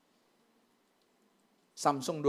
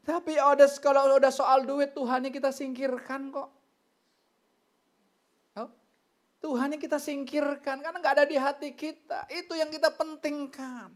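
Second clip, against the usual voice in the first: high, insistent sermon voice; raised, emphatic preaching; full-force preaching; 11 semitones higher, 6 dB louder than usual. Real, not acted. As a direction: drive the rebuke home to the congregation.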